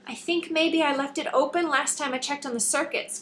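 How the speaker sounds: lightly voiced young assistant, a bit sheepish; a hint of a guilty young girl owning up; young Callie. A narrator's reading. Callie's voice in the story.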